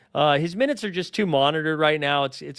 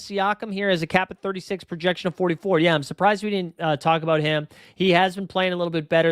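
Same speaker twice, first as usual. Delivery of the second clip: ordinary delivery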